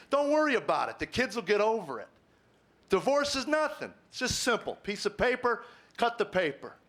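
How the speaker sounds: a preacher lightly parodying easy advice; mock dismissive voice. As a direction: toss it off like it's nothing, mockingly. Mocking culture's careless advice.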